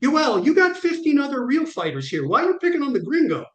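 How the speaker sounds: high-pitched mock complaint; retold jokey whine to a tougher fighter; joking protest. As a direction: plead your case jokingly, pitched right up. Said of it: "I tried to make a joke".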